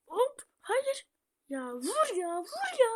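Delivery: frantic high yelling. Real, not acted. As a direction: yell at the game in frustration. Frantic game moment.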